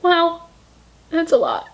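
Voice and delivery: usual speaking voice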